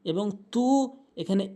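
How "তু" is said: তু with its oo stretched one beat; held vowel. Shown as a demonstration. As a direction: lengthen the vowel of তু. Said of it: "তু এক আলিফ টানবো".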